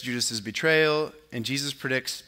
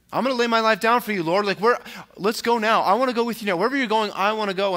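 fast, higher and louder, bursting with eagerness; eager disciple; 7 semitones higher, 5 dB louder, faster than usual. Retold or imitated. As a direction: blurt it out, keen to follow right now. Acting out Peter's eager bold response.

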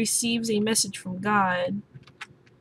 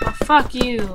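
louder, irritated swear at the game; frustrated outburst; annoyed curse; 3 semitones higher, 7 dB louder than usual. Real, not acted. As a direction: spit it at the screen.

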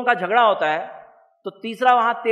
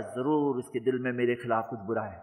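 low, soft suspicious muttering to himself; suspicious inner thought; 10 semitones lower, 8 dB quieter than usual. Retold or imitated. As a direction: voice the man's brooding suspicion, low and hushed. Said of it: "اب وہ سوچ رہا ہے".

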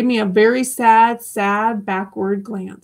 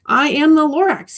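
a touch of a raised, coughing Lorax; 6 semitones higher, 4 dB louder than usual. Acted. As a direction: push it out between coughs, lightly. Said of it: "he coughed and he whiffed".

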